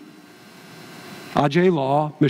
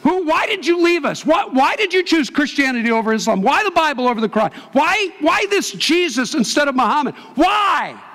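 retold angry shouting; 12 semitones higher than usual. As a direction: shout the questions as the furious kidnappers. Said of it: "they're screaming at him, and they say".

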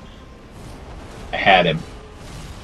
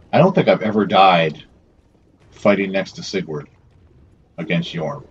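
ordinary talk, nothing marked

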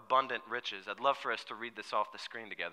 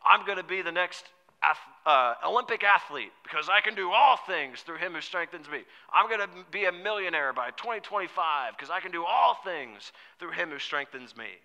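louder, higher cocky boast; mock bragging; 5 semitones higher, 7 dB louder than usual. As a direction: brag like an overconfident believer misusing the verse. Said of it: "We say things like".

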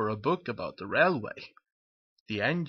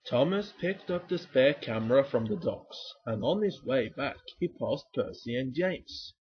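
plain reading voice

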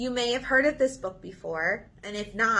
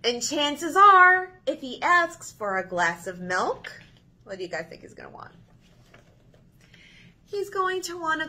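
lively picture book reading; reading to children; 6 semitones higher, 4 dB louder than usual. Acted. Librarian reading If You Give a Mouse a Cookie to children, expressive. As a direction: read it with big expressive turns for kids.

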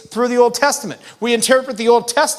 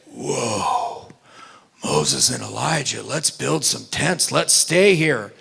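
lower, slow, dazed Peter voice; Peter awestruck; 9 semitones lower, 4 dB quieter, slower than usual. Acted. Preacher voicing Peter at the mountaintop, lower and slow. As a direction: play Peter stunned by the vision, drawn out low. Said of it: "What does Peter say?".

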